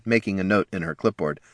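plain reading voice

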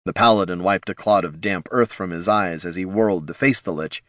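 ordinary delivery

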